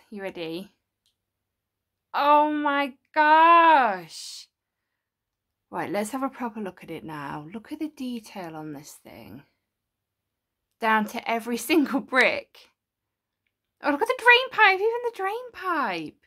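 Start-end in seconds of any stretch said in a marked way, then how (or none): 2.1-4.5 awed. Real, not acted. Sees the model lit up. 13.8-16.2 delighted. Real, not acted. Delighted by small details.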